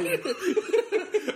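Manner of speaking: high, laughing are you; surprised laugh. Real, not acted. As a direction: jump up in pitch, amused and surprised. Surprised by Facebook friend claim.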